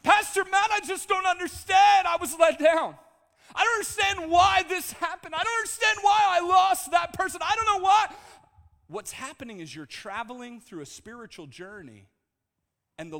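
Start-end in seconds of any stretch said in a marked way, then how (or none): 0.0-8.1 high, very loud anguished shouting; acted, hammed-up shouted plea; distraught churchgoer's outcry. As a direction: wail it out to the pastor in despair. Distraught churchgoer pleading with pastor.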